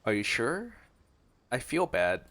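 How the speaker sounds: ordinary delivery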